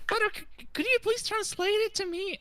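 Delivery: very high, squeaky cartoon pony voice; Pinkie Pie. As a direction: squeal it like an eager little pony. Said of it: "Pinkie Pie heard a song about balloons".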